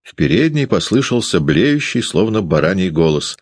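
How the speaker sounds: ordinary delivery, nothing marked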